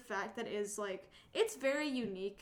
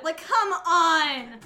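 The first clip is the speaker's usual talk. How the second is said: exasperated come on; 6 semitones higher, 14 dB louder, much slower than usual. Real, not acted.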